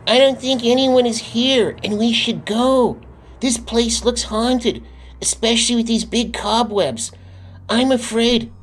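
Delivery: put-on very high, scared kid voice; Bob afraid. A narrator's reading. Bob scared at the haunted gate, very high frightened voice. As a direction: plead to leave, frightened. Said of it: "said Bob".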